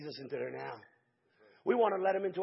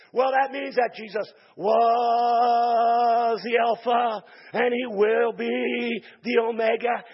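slow mock explanation; 3 semitones higher, 8 dB louder, much slower than usual. People misreading scripture.

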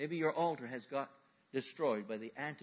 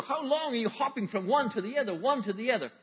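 ordinary talk, nothing marked

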